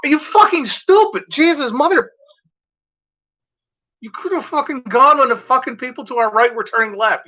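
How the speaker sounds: screaming in the car at a driver who turned late; road rage rant. Retold or imitated. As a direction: blow up at the car ahead in a high yell. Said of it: "And I'm like".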